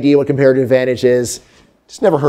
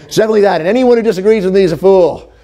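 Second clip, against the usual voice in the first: louder, puffed-up know-it-all declaration; hammed-up smug insistence, like a pundit who knows nothing; mock arrogant certainty; 5 semitones higher, 3 dB louder, faster than usual.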